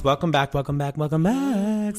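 high, lively host welcome; show host. Acted. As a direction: greet the audience like a host. Show segment intro.

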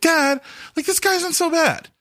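put-on high, pleading kid voice; whiny son voice. Son begging his dad to spare David, whiny and high.

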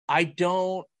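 ordinary delivery

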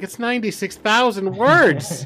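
exasperated retort. Retold or imitated. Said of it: "And I'm like".

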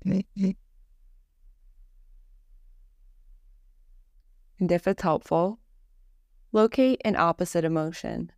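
measured Yoga Nidra narration, little pitch movement; guided meditation. Acted.

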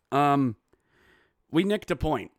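ordinary talk, nothing marked

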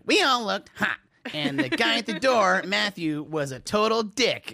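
put-on high, indignant party-girl voice; whiny complaint, up and loud; mocking Yelp reviewer. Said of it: "And then they just write".

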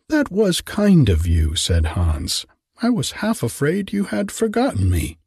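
a simple grateful little gardener; Hans, meek gardener. A narrator's reading. Hans voiced higher.

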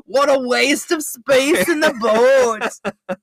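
high, slow fake Aussie accent; botched Australian accent. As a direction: drag out the jeer in a bad Australian accent. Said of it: "what the fuck accent we're slipping into".

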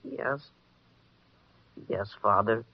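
quiet, broken yes; sad. Acted. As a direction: give in with a heavy heart. Boy gives in, heartbroken.

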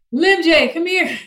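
put-on calling the kids. Mom calling both kids by their nickname, loud call. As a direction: sing out the nickname to summon them. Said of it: "I'm supposed to say".

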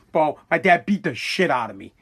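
ordinary talk, nothing marked

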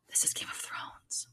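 hushed, reverent whisper; whispered in wonder; awed whisper. Retold or imitated. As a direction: breathe it out in awe. Awed reaction to the theme music.

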